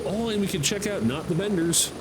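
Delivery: normal talking voice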